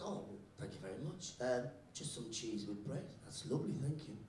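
soft, low polite order; polite reply. Acted. New kid thanking the teacher for lunch, softly polite. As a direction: answer quietly and courteously.

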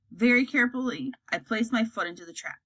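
usual speaking voice

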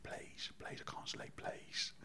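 slow, voiceless begging, acted out; whispered pleading. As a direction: beg in a drawn-out whisper. Begging crew on night flight.

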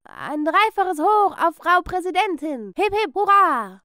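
loud, high, slow cheer; rally the crowd with a big hurrah; voiced schoolmates cheering.

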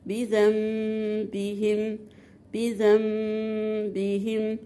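slow Quranic demo of "بذنبهم", holding the "mm" before the ba; iqlab with ghunnah. Shown as a demonstration. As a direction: recite "بذنبهم" slowly, the noon turned into a long hummed meem. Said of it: "هتقوليها إزاي؟".

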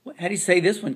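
normal talking voice

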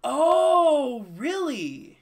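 shocked high "Oh, really?"; surprised. Real, not acted. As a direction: caught completely off guard. Shocked at hidden game rule.

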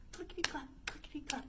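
ordinary delivery

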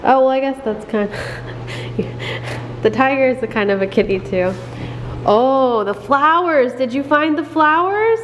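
sing-song picture book questions; reading to a toddler. Acted. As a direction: coax the baby to point things out.

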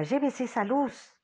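grito más agudo y lento, tapándose la cara. A narrator's reading. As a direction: ordena que aparten la luz. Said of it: "se tapa la cara con las manos y grita". (grito agudo de Peter, cegado)

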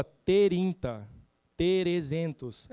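"terinta" e "terezentos" bem arrastados, com vogal extra; slow speech drill. Shown as a demonstration. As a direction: separa cada sílaba: "te-rin-ta, te-re-zen-tos". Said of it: "Aí eu falava". (treino de dicção, bem devagar)

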